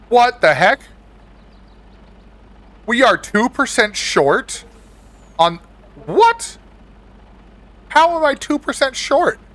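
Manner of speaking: shocked disbelief; high, baffled what; surprised. Real, not acted. Game surprise, confused outburst.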